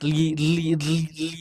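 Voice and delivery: "li" menggantikan "ri", seperti orang pelo; lisped R as L. Retold or imitated. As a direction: tiru orang pelo, R jadi "li". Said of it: "kasroh nggak kelihatan". (meniru pelo, R jadi L)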